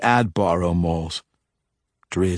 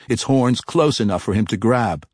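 usual speaking voice

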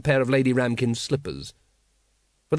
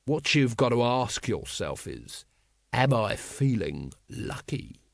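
a lightly voiced hard-edged gunman threat; cold knife-edged threat; slower than usual. A narrator's reading. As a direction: let each word cut. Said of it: "his voice buzzed like a knife blade".

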